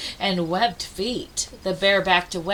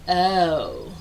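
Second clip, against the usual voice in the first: disappointed; 5 semitones lower, 3 dB quieter than usual. Acted. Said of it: "a chorus of".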